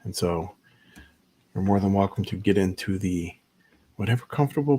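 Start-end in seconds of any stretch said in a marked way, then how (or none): none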